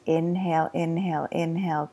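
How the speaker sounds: ordinary delivery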